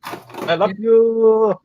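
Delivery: high, slow put-on voice; friend impression. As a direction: stretch it out sweetly high. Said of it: "That's exactly how he sounds".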